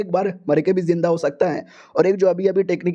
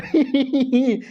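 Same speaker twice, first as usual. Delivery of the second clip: high, smug mocking laugh; the hero laughing at the girl's helplessness; 6 semitones higher than usual. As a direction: laugh at her like you've won.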